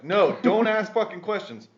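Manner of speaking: high, loud panicked yell; retold frantic shout at wife; screaming. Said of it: "I'm screaming at her".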